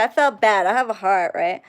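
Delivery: normal talking voice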